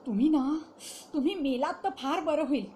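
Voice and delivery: loud, bitter outburst through clenched teeth; teeth-gritted anger. A narrator's reading. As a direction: spit the curse out loud and hard. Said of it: "ती दात ओठ खाऊन म्हणाली". (दात ओठ खाऊन संतापाने)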